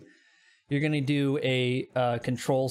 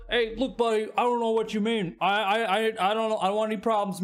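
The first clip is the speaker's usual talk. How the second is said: higher, stammering backing-off voice; acted nervous appeasement, pitched up; nervous pleading; 6 semitones higher than usual. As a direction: play a man backing down from trouble. Threatened vendor pleads to avoid trouble.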